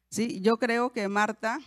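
ordinary talk, nothing marked

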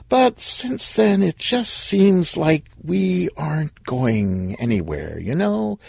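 high, slow, tentative voice; drawn-out, pleading uncertainty; reluctant girlfriend, hesitant. Acted. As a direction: stretch it out, easing into bad news. Woman gently breaking up with boyfriend.

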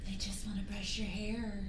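very soft, low coaxing; hushed mock old woman; sly coaxing whisper. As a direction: coax her in quietly.

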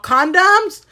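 high, loud mock disbelief; loud blurting. Clerk echoing a shy customer's request, loud high blurt. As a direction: blurt it for the whole shop to hear. Clerk repeats it far too loudly.

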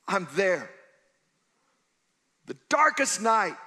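loud, high preaching shout as God's voice; God's promise, shouted. Acted. Preacher booming God's vow to the congregation, full shout. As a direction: thunder it out as God swearing never to leave.

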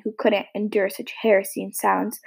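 plain reading voice

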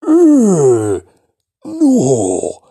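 very loud, high eh no eh; disbelieving eh no. Shown as a demonstration. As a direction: laugh off the news in disbelief.

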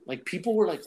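usual speaking voice

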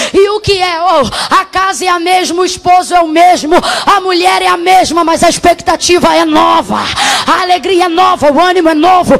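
loud, shouting sermon delivery; preaching at full force. Acted. Preacher shouting over the music to the congregation, full-force preaching.

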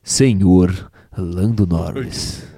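deep, slow, velvety radio voice; sultry narrator. Shown as a demonstration. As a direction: purr it like a late-night DJ. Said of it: "Tem que ser com mais, mais punch".

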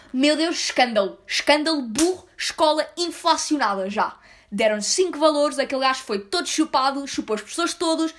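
loud mocking outcry of scandal; mock outrage. Twitter critics mocking a private school student, loud mock outrage. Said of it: "vai com um dezassete a exame e tira um doze".

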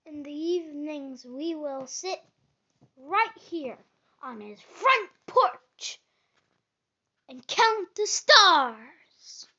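big eager storybook Frog; excited. A narrator's reading. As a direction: paint the summer plans with rising excitement. Frog excitedly describes summer plans.

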